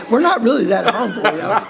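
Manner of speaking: laughing through a playful boast, voice high. Real, not acted. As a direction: grin and chuckle the admission. Joking boast about humility.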